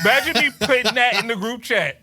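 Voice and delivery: words broken up by laughter; laughing. Real, not acted. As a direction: laugh through the line. Laughing while joking.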